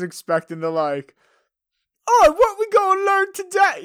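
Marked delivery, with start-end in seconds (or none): 2.1-3.8 high, loud, hammed-up British accent like Pinky; a cartoonish British schoolkid voice; mock British accent. Expecting the new English girl, mock British accent. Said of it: "that was very Brain from, or Pinky".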